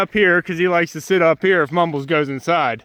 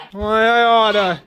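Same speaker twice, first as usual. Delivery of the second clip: slow, hammed-up cartoon grumble; lightly voiced old-comedy threat; mock stooge threat; 3 semitones higher, slower than usual. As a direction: playfully shake a fist at the goose. Mock threat to stubborn goose.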